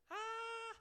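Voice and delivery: a very high, loud sung "Ah"; teasing imitation of bad singing; mock singing. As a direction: belt a silly high note to mock his own singing. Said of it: "telling me that that's how I sound, you know, singing".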